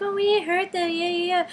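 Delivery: high, mimicking girls' voice; mock the girls' excuse in a high voice; mocking denial. Girls deny hurting the band, acted.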